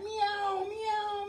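high-pitched playful meowing like a cat; high cat meows. Pretend. Fitness host to class doing cat stretch, high playful meows. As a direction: mew like a cat to cue the stretch. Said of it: "round that back like a cat".

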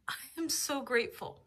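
ordinary talk

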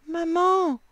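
put-on child asking mum. A narrator's reading. Lily calls her mother to ask something, child asking. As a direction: call up to mum like a little girl. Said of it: "demande Lily".

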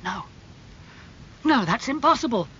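high-pitched shocked denial; crying out. A narrator's reading. Dorian hears Sybil is dead, crying out. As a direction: burst out in disbelief. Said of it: "cried Dorian".

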